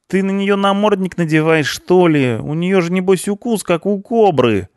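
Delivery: very high, loud complaint; alarmed punk. A narrator's reading. Punk hiding from the cleaner behind boss, alarmed. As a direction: protest in a high panicky voice.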